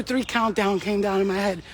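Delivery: normal talking voice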